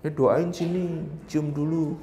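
soft, slow fatherly coaxing; tender father. Retold or imitated. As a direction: gently call a small child over for a blessing. Father tenderly blessing his daughter.

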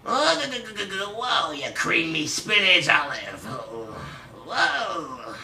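punchier mocking cartoon sailor; Popeye. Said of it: "You do a Popeye impression then".

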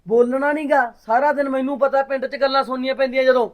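loud, high scolding yell; angry shouting. Acted. Brother shouting at his sister over village gossip, furious. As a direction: yell at her to stop running around.

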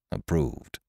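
low, soft, curt assent; curtly, well down in pitch. Acted. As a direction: approve it in one quiet word. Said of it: "added Ned Lowe curtly".

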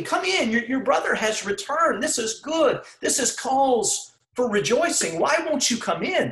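high, pleading father's voice. Retold or imitated. Father begging his angry son to come in, pleading. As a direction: beg him to come inside. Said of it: "His father's begging him".

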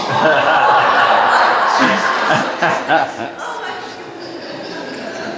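long breathy laugh at the joke; real laugh. Real, not acted. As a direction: laugh freely, deep in the chest. Congregation laughs at church sign.

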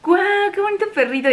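high, squealed mock delight; imitating a coworker's high squeal; excited squeal. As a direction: squeal over a cute puppy. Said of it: "una amiga así gritando".

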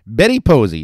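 loud, high self-introduction; grand announcement. A narrator's reading. Madam introducing herself to a newcomer, grandly announcing. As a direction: announce the name with glamour. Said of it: "she announced, assuming her most glamorous".

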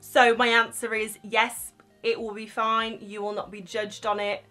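normal talking voice

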